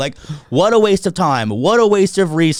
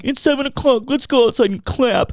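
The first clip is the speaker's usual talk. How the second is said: mocking clappers; 11 semitones higher than usual. Mocking neighbours thanking medical workers, high and syrupy. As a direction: gush like a smug balcony clapper.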